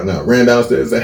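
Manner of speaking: ordinary talk, nothing marked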